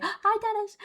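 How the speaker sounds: sudden very high-pitched hello; gasp, then greet in a tiny high voice; delighted high squeal of greeting; excited greeting. Real, not acted. Sudden greeting mid-story.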